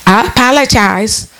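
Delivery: slow put-on voice, mocking the I apologize; imitating hollow apology. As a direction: mimic someone who doesn't mean it. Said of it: "they gonna say it to somebody".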